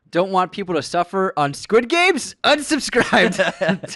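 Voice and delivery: high, loud indignant read building to a drawn-out yell; hammed-up angry announcement; mock outrage. Host reading a joke complaint to co-host, mock outrage.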